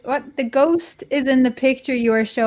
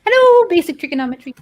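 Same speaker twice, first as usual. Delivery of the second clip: bright, quick, higher hello; excited greeting; 8 semitones higher, 4 dB louder, faster than usual. Acted. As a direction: greet them with a burst of cheer. Greeting viewer with fanfare.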